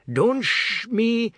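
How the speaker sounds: rounding on his mother in fury; screamed. Acted. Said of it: "Pratap screamed".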